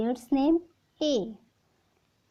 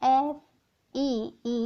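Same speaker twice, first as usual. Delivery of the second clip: letters spelled very slowly; slow spelling; much slower than usual. Shown as a demonstration. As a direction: spell each letter out drawn long.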